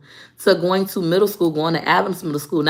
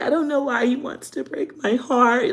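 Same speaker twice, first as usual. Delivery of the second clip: high, tearful heartbreak imitation; hammed-up lovesick sobbing voice; mock crying; 7 semitones higher than usual. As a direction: whine it like your weepy younger self. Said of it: "I would just cry".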